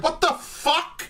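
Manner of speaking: incredulous. Real, not acted. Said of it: "And you're like".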